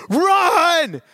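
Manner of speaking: high, loud, drawn-out yell of alarm; urgent shout. Retold or imitated. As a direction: holler it to get the team moving. Shouts to team as elephant charges.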